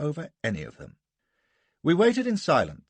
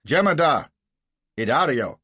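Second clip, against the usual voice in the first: loud sharp summons down the hall; calling out; 5 semitones higher, 9 dB louder than usual. Acted. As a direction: call the servant in, loud. Calling for the under-officer.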